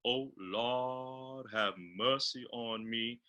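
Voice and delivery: slow, round every vowel; sing-speak it with tall round vowels. Shown as a demonstration. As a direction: spiritual dialect with rounded vowels. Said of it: "so my vowels are rounded".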